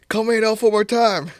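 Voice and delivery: angry elf threatening Buddy; mock angry threat.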